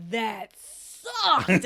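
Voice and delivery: loud, emphatic mock disgust; retold blunt verdict. Co-host's verdict leaving the theater, loud and blunt. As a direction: hit it hard and loud.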